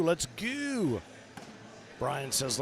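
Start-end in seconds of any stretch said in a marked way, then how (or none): 0.0-1.0 high, stretched hype cheer; gung-ho. Pretend. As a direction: belt it out like a fan chant. Reading hype chat comment aloud.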